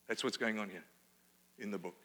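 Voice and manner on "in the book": ordinary talk, nothing marked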